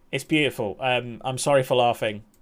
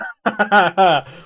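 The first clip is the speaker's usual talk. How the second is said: loud, higher burst of laughter; real laugh; 5 semitones higher, 7 dB louder than usual. Real, not acted. Streamer scrolled too far on Google Images, laughing. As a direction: laugh out loud at what turned up.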